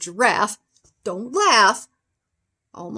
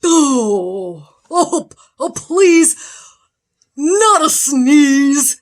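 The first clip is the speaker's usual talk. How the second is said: high, whimpering plea; frightened bear begging not to sneeze; frightened pleading; 3 semitones higher, 6 dB louder, slower than usual. Acted. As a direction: beg like a scared bear.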